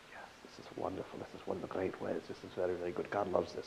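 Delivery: low, very soft approval; rabbi's quiet delight; hushed rapture. Acted. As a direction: murmur it as if savouring every word. Rabbi savours approving the act.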